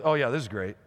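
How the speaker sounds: low, dry mock enthusiasm; underwhelmed king, voice dropped and deflated; unimpressed Saul. Saul weighing up a kid against a giant, dryly doubtful. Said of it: "Saul's just really filled with".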